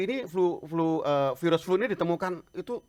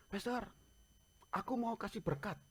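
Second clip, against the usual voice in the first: friend asking to bless; 8 semitones higher, 8 dB quieter than usual. Retold or imitated. Friend's message voiced by pastor.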